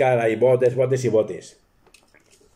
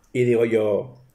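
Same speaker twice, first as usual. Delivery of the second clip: ordinary delivery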